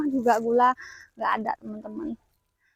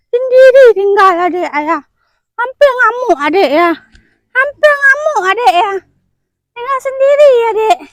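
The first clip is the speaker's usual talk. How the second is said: exaggerated baby talk; 10 semitones higher, 8 dB louder than usual. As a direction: play up the baby's complaint in a sing-song high voice. Voicing the upset baby's complaint, cooing. (suara manja menimang bayi, tinggi dan nyaring)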